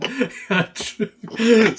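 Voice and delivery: high, loud burst of laughter; real loud laugh. Real, not acted. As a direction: crack up loudly. Laughing at rich rappers.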